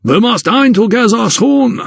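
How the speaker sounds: high-pitched call back on the way out; high, carrying character voice. A narrator's reading. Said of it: "he called back".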